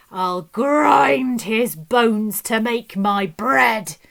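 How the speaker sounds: loud booming giant chant, slow at the end. A narrator's reading. Giant stomping through the castle hunting Jack, booming. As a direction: boom it out like a hungry giant. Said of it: "he said".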